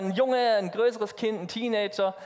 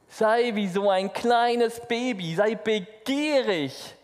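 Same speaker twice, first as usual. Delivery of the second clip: drawn-out, longing plea, a bit louder; slow, yearning appeal to the congregation; slow eager urging; 4 semitones higher, 5 dB louder, slower than usual. Acted. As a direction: stretch the words as if hungry for them. Preacher urging eager hunger.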